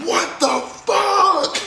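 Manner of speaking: high, slow shout breaking into a laugh; stunned yell at rocks hitting the RV; retold shout. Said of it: "and I was like".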